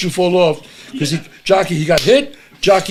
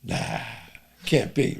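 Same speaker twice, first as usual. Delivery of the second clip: soft, low aside to himself; inner thought; 6 semitones lower, 11 dB quieter, slower than usual. Retold or imitated. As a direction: think it under your breath, dismissing the idea. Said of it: "in my mind, I'm going".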